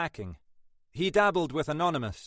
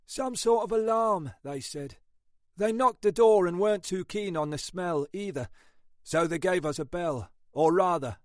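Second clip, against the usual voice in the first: higher, world-weary copper voice; a tired British copper reporting grimly; weary detective sergeant; 8 semitones higher than usual. Acted. Ashford reports the crime scene.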